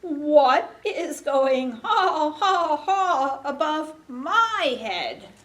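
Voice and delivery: big slow animal voice for a picture book; storybook sheep. Acted. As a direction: draw out the ha's, loud and high. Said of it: "And the sheep says".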